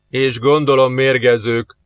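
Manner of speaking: slow, suspicious grumble; drawn-out sour remark from a sergeant; grumbling. A narrator's reading. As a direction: grumble it slowly, expecting the worst. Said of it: "morogta az őrmester".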